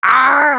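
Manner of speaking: loud, high mock-horrified "aah"; horrified scream. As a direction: scream as if dying of cringe. Horrified reaction to retold quote.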